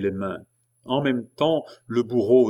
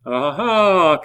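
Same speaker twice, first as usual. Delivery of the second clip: high, loud, drawn-out jeer; loud exclaiming "ah"; crowd's cry; 8 semitones higher, 8 dB louder than usual. A narrator's reading. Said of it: "cria la foule".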